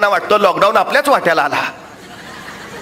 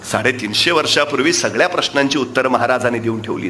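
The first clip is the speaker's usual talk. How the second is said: usual speaking voice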